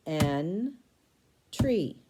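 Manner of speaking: "N-tree" broken into "N" and "tree"; "N-tree" said in two separate beats; syllables split. Shown as a demonstration. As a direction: split it after the N. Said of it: "Next word".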